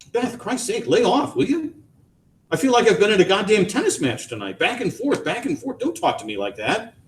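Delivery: high, fed-up plea; exasperated. A narrator's reading. Husband begging wife to lay off, exasperated. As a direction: beg her to stop, worn out.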